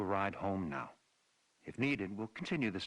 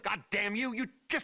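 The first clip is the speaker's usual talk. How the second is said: angry shout; 14 semitones higher, 4 dB louder than usual. Acted. Chief yells at her.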